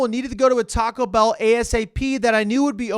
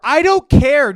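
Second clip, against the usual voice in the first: loud, careless retort; defiant shout; 7 semitones higher, 9 dB louder, slower than usual. Acted. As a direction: yell it back defiantly.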